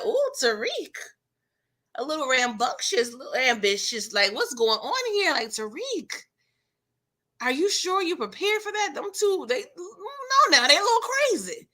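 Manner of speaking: hammed-up anxious voice; mock worried warning. Warning Tariq about Tommy and Monet.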